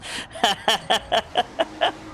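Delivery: airy giggle, rising; high breathy laugh. Real, not acted. Laughs after teasing.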